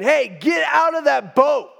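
loud, high angry shout from the shore; imitating an angry God yelling from the shore. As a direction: bark the order at him like a stern God. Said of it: "in an angry voice saying".

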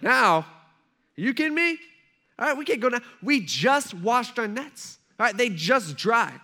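high, loud acted disbelief; incredulous protest. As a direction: object in disbelief, stretching the words. Partners object to fishing now.

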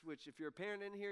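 usual speaking voice

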